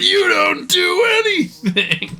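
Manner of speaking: high, slow mocking tease; teasing jab. Real, not acted. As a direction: needle him playfully. Teasing friend.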